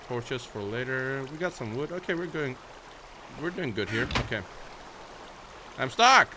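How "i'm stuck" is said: sudden panicked yell; alarmed shout. Real, not acted. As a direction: blurt it out as you get caught. Trapped in game, cries out.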